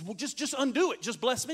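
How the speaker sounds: hurried, high-pitched plea; urgent pleading. Acted. Esau begs father to undo it.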